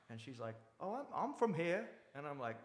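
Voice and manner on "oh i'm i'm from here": put-on higher, slow, foreign-sounding accent; imitated English-Australian-sounding accent. Woman at a bar answering, higher English-Australian-like accent. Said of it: "a English or Australian accent".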